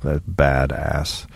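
low, slow rumble; deep voice. Pretend. Holding the low voice.

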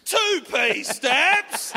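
slow, high, drawn-out mock butcher; butcher's voice. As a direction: stretch it out like a menacing shopkeeper. Mocking the butcher's voice.

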